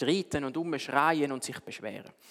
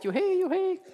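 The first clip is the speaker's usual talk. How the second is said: much higher cheering animal voices; joyful cheer. Acted. The animal children celebrate the owl's words with a joyful, much higher cheer. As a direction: cheer it like happy animal kids. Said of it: "alle Tiere haben sich gefreut".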